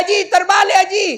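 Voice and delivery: high, loud imitated warning; hammed-up exasperated calling; mock shouting. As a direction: yell to get Haji's attention. Shouting to correct old man's handset.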